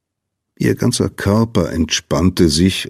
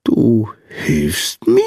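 slow, hopeful squeaky question; lightly voiced small sandman, touched; delighted little creature; 3 semitones higher, much slower than usual. A narrator's reading. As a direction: ask with dawning, disbelieving joy. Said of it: "fragte der Sandmann beglückt".

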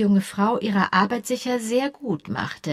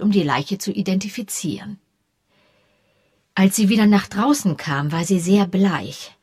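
ordinary delivery

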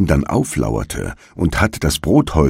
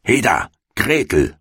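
impatient witch calling out, pitched up; zurufend; 6 semitones higher than usual. A narrator's reading. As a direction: call the girl over sharply, without much extra volume. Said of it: "rief sie dem Mädchen zu". (ungeduldig zurufend)

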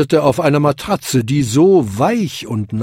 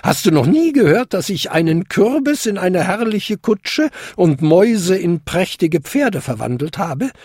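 put-on high old-lady voice; high-pitched, proudly incredulous questioning; boastful fairy godmother; 7 semitones higher than usual. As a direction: show off past magic, pitched high.

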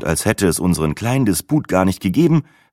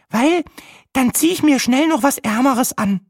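much higher female voice; weather girl voice. Acted. Weather girl answering the guide, much higher. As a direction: say it high and naive.

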